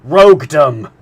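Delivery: enraged harpooner retort; furious. A narrator's reading. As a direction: fling the word back. Ned's angry retort.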